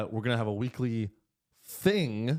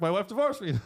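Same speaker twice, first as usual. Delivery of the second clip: auctioneer voice; 8 semitones higher than usual. Retold or imitated. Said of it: "real auctioneers, what they're really saying is".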